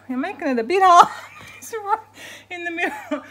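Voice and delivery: real amused laughter mid-workout; laughing. Real, not acted. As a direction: giggle it out while a dog blocks you. Dog sitting on her exercise mat.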